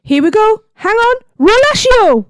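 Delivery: high, rousing calls into a shouted spell; excited spell caster. Acted. Hermione frees the dragon with a spell, high excited shout. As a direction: build from warning to loud incantation.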